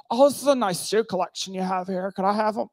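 high, put-on covetous voice; mock admiring guest. Preacher mimicking a guest eyeing his shoe collection, high mock admiration. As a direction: imitate a cooing, cheeky asker.

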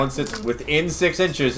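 ordinary talk

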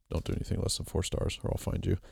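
whispered half-joking threat; mock threat. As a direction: hiss it like a playful menace.

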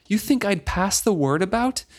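taken aback and indignant; outraged. A narrator's reading. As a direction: read it as an offended, incredulous question. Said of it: "He was outraged".

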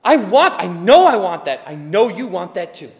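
loud, high preaching shout; preaching at full force. Real, not acted. Pastor shouting to the congregation about money and joy, full-force preaching.

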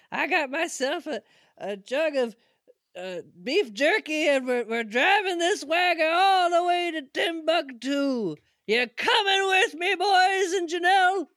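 high cowboy voice, lightly done; a hint of a folksy cowboy. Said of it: "whole cowboy themed intro".

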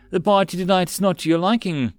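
high, sweetly polite lady. A narrator's reading. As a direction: feign sweet concern as the hostess. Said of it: "a sweet voice came over".

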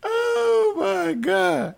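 very high, long drawn-out wail; appalled disbelief. Real, not acted. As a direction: wail it slowly in amused horror. Reacting to a disastrous band round.

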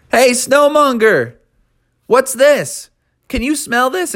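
high, teasing call; yelling to lure. A narrator's reading. Townsfolk calling out to tempt Snowmonger, teasing call. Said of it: "they all yelled".

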